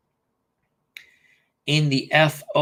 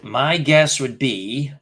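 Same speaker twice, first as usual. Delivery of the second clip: drawn-out, slow thinking aloud; slow stalling; much slower than usual. Real, not acted. Stalling on the trivia question, slow drawn-out musing. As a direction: stretch each word while you think.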